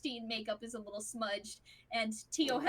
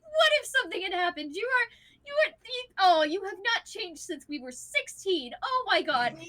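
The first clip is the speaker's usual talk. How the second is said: raised, loud sputtering reproach; exasperated scolding; 8 semitones higher, 8 dB louder than usual. Acted. Wife to husband who never changes, sputtering exasperation. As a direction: tell him off, tripping over your words.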